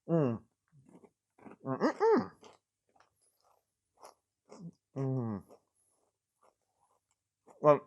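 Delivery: talking through crunchy bites; crunching while eating. Real, not acted. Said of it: "you can hear me crunching away".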